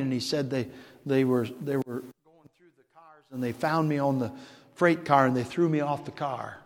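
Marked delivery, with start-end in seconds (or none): none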